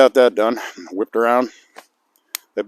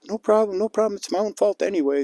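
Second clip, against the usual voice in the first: put-on old lady voice; 11 semitones higher than usual. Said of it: "the old lady says".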